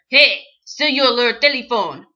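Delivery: imitating a loud, weird foreign accent; Tommy Wiseau. Director catching an actor texting, loud accented call. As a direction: call out sharply in a sort-of-French accent. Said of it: "I can hear yelling in this weird accent".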